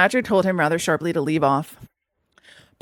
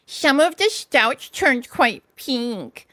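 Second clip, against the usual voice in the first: slow, higher little-Mole voice; gleeful Mole; 4 semitones higher, much slower than usual. A narrator's reading. Mole continuing his tale, gleeful. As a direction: savour the gossip. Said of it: "continued the Mole".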